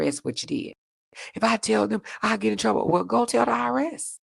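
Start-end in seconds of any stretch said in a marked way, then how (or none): 1.3-2.9 whiny ex, pitched up; mock the ex's lame excuse in a higher voice; lightly voiced whining ex; imitating ex. 2.9-4.2 higher, taunting go tell the I R S; mocking comeback; sassy retort.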